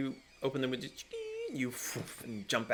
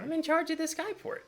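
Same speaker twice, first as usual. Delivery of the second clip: much higher pixie voice. Acted. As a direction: announce it in a high pixie voice. Keeper announces her post.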